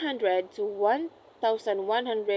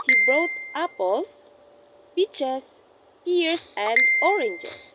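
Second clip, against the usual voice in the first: apples and peaches rising, oranges falling slowly; list intonation. Shown as a demonstration. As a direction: rise on each item, fall on oranges.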